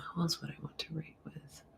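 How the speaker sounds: whispered aside to herself; whispering. Real, not acted. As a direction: breathe it out as a whisper.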